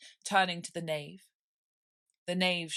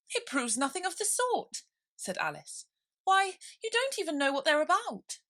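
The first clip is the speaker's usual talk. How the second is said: high, lightly voiced girl; indignant Alice; 11 semitones higher, 4 dB louder than usual. Alice protesting to the king, high and indignant.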